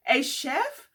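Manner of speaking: put-on high curious child voice; a little boy perking up; boy's surprised question. A narrator's reading. Riley asks cousin Joe, high curious child voice. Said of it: "said Riley".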